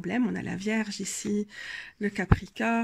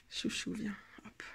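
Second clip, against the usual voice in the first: whispered, unvoiced call to the cat; whisper to pet; 14 dB quieter than usual. Real, not acted. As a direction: murmur to the pet under your breath. Calls her pet softly. (chuchoté à son chat)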